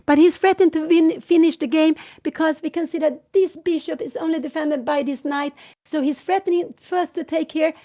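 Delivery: loud, urgent live chess commentary; excited commentator leaning into the board; live play-by-play. Acted. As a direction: call every move like it matters.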